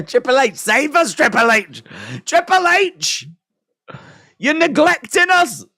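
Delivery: high, hammed-up pleading cry; mock plea. Fan begging Triple H to save them, mock pleading. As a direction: beg Triple H to rescue them, half in jest.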